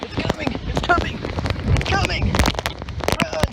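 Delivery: high panicked yell, warning everyone; panicked shouting. Real, not acted. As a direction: scream at people to run. Storm rushing at them.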